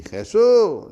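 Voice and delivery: loud, high, stretched-out cry. Retold or imitated. Blind man who will not be silenced, shouting. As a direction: yell the name out long. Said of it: "Y él gritaba más".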